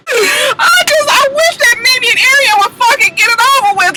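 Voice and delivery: high fast frustrated shriek; exasperated squeal. Real, not acted. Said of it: "exasperated reaction".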